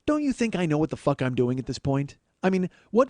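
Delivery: ordinary talk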